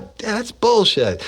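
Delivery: high, slow mock-boss scoff; imitating the boss. Boss Kearney voiced in story.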